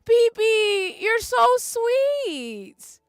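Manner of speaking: high, very slow, tearful; crying thanks to a viewer. Real, not acted. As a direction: stretch it out through tears.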